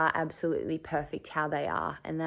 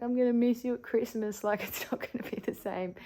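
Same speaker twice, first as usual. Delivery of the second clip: higher, half-laughing, tearful goodbye; tearful farewell to Pop; 6 semitones higher than usual. Retold or imitated. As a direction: sadly tell your late grandfather you'll miss him. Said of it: "kinda saying to Pop".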